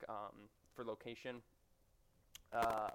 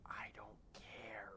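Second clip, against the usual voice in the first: quiet unvoiced whisper, slow; 6 dB quieter, much slower than usual. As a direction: whisper it with playful defiance. Mock defiant aside to viewers.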